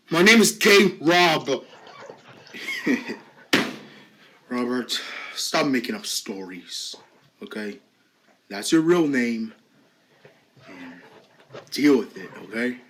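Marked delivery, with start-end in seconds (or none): 4.5-12.9 a mocking teacher talking down to a patient; low bully teacher. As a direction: taunt him low and smug like a cruel teacher. Voices a taunting bully teacher.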